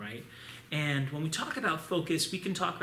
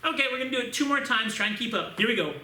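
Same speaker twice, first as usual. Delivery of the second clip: higher, louder, quicker pep call; energized; 8 semitones higher, 7 dB louder, faster than usual. Real, not acted. Instructor hyping students for two more rounds. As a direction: fire the class up for the last rounds. Pumping up class.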